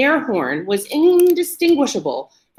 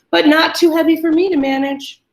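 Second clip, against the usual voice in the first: higher, louder sly menace; menacing taunt; 8 semitones higher, 5 dB louder than usual. A narrator's reading. Cindy taunting the chained man, menacing. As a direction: savour the threat.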